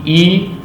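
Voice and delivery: ई held long and high. Shown as a demonstration. Teacher shows the long sound to students, drawn out. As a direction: stretch ई into a long ee. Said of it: "वो बन रही है ई".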